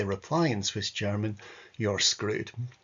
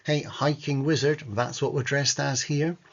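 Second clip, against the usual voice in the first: ordinary delivery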